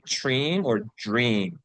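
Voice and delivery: first "dream" starts with ch, second "dream" with j; ch versus j. Shown as a demonstration. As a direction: slowly contrast "dream" as "chreem", then as "jreem". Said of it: "do you think it is ch or j".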